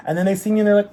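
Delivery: ordinary delivery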